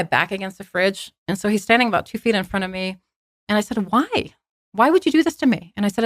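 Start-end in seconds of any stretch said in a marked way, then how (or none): 3.9-5.7 high-pitched, pleading why; acted-out hurt confrontation; retold anguished demand. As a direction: plead for an explanation, pitch jumping up. Acted confrontation with lying fiancé.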